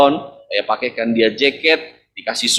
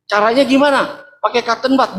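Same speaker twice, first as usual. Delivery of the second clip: higher, puzzled asking; puzzled question; 9 semitones higher than usual. Retold or imitated. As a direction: ask as if you can't believe the idea. Puzzled question to his mother.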